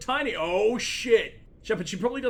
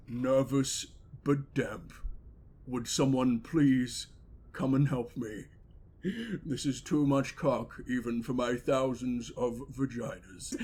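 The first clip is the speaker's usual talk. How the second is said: low, slow put-on voice; slow, low mock plea; imagined character's voice; 7 semitones lower, 3 dB quieter, slower than usual. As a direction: play it deep and drawn out, pausing between phrases.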